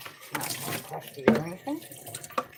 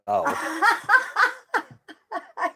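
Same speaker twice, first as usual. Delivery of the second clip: laughing; 14 semitones higher, 8 dB louder than usual. Real, not acted. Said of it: "You're hilarious".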